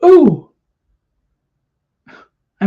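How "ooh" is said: high, loud hammed-up ooh of a gawker; mock the crowd drooling over boobies; exaggerated high-pitched mock ooh; mock leering ooh. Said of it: "it's like".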